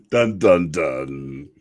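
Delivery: sung dun-dun-dun, falling away; dramatic sting. Acted. Co-host capping the ominous line, mock dramatic sting. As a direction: intone a suspense sting.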